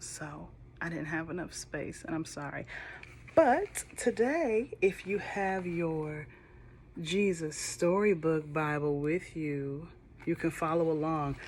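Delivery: usual speaking voice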